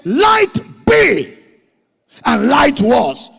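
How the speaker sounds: shouted high and loud as God; booming command. Acted. Preacher booms God's first command. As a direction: roar the command out.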